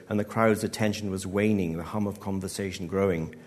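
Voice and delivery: usual speaking voice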